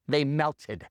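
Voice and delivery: dropped-pitch, matter-of-fact statement; low deadpan repeat. Real, not acted. Punchline repeated for weight.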